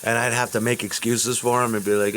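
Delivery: ordinary talk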